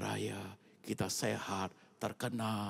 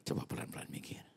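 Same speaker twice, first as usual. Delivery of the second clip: whispered, drawing the listeners in; hushed whisper; 3 dB quieter, faster than usual. Real, not acted. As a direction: lean in and whisper the thought. Preacher hushes congregation to think.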